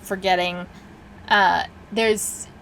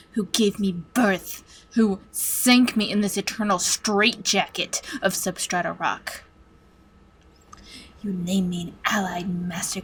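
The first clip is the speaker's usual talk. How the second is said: measured menace with long deliberate pauses; vengeful master computer. Acted. Supercomputer recounts its birth to its captives, brooding menace. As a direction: swell on the self-naming, then sink back into cold menace.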